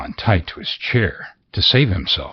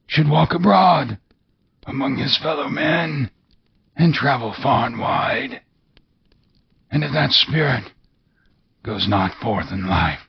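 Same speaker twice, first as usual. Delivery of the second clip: high, wailing otherworldly voice; mournful ghost; 10 semitones higher than usual. Acted. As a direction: lament like a doomed spirit.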